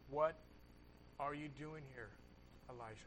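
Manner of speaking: God's quiet question in the cave; low whisper of God. Acted. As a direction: ask it gently like a still small voice. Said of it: "the sound of a low whisper".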